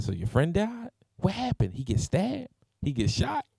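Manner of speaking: put-on a kid pestering his dad for gory details; eager kid. Son pestering his father about the death, eager and high.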